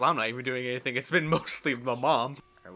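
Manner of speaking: ordinary talk, nothing marked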